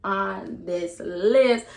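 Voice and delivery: normal talking voice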